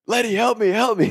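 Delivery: high-pitched retold plea; mock distressed cry, not full volume; pleading for help. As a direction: call to a passing friend for rescue. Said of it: "Beef said he's screaming".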